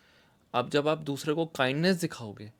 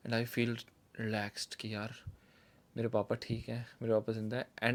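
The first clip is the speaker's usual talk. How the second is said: relieved and soft; 4 semitones lower, 8 dB quieter than usual. Retold or imitated. Relief after nightmare.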